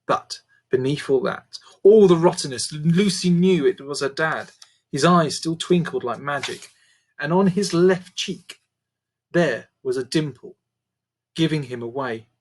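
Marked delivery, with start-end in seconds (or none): none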